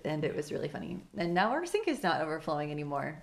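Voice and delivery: ordinary talk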